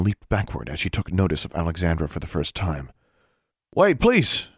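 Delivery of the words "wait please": high, loud urgent plea; raised, beseeching call; pleading. A narrator's reading. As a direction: plead with them to wait. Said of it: "Maximilian pleaded".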